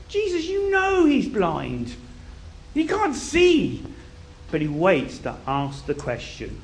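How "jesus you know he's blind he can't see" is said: high, drawn-out incredulous complaint; mock exasperated protest. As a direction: stretch it out high, as if pointing out the obvious. Mock protest at Jesus's question.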